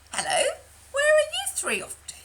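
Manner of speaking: very high, loud, fluttery greeting; high swan voice. Acted. Swanee Donny greets the three, high loud call. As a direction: sing out the hello like a swan.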